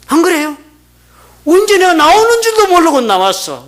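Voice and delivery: loud, high mock complaint; aggrieved protest. Preacher voices people pushed into the world, loud aggrieved protest. Voices man's grievance about birth.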